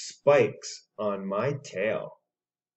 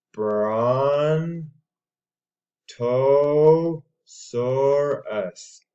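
stretched-out name; 3 semitones higher, 5 dB louder, much slower than usual. Shown as a demonstration. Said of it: "slow syllable-stretched model".

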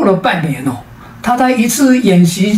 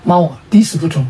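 ordinary delivery

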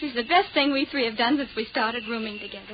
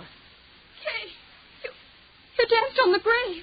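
breathless, high horrified voice; 6 semitones higher, much slower than usual. Acted. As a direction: gasp it out in horror. Horrified discovery.

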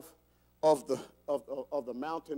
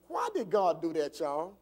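ordinary talk

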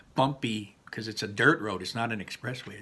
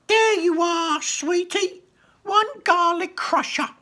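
very high, sugary goblin voice; goblin shopkeeper; 7 dB louder, slower than usual. Acted. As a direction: play a sweet-talking goblin, high and slow. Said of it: "she said".

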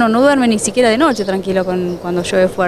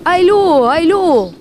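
retold warning yell; 8 semitones higher, much slower than usual. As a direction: imitate a neighbour calling out a warning. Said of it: "el vecino me grita".

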